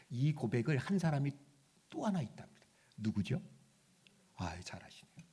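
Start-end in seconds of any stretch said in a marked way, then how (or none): none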